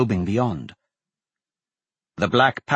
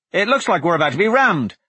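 alarmed; 7 semitones higher, 4 dB louder, faster than usual. Acted. Gaping at oncoming ship.

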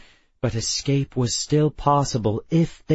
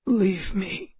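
put-on high, soft, croaked Russian-accented plea; croaking; 8 semitones higher, 3 dB quieter than usual. A narrator's reading. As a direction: croak it out, dying. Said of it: "he croaked".

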